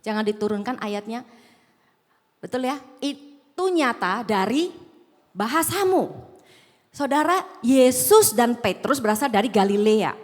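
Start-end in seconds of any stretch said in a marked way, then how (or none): none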